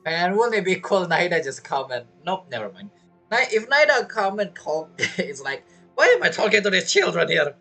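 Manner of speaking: ordinary talk, nothing marked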